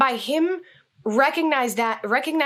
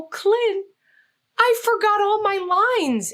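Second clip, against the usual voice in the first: much higher, anxious confession; flustered panic; 11 semitones higher, slower than usual. Retold or imitated. Actress confessing to Clint she forgot her lines, high and flustered. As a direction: blurt it out, alarmed at your mistake.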